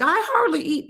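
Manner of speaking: very high mocking mimicry; exaggerated high-pitched imitation of her; imitating a dieter's claim. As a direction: squeak out a mock-innocent protest. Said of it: "she's like".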